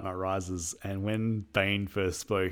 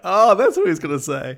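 realization; 10 semitones higher, 12 dB louder than usual. Retold or imitated. Mock realization.

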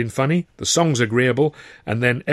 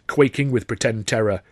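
usual speaking voice

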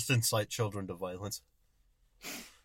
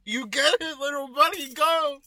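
high, loud excited cheer; root for a little kid at full voice; hyped-up shouted encouragement; 8 dB louder, much slower than usual. Acted.

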